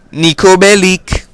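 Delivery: slow, high, loud character voice; Nico Bellic. Acted. Vlogger signing off the game impression, exaggerated character voice. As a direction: draw the name out in a put-on game-character voice.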